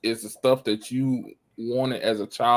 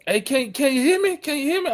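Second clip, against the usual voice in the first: imitating a loud dad; 11 semitones higher than usual. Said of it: "They hear you in the next state".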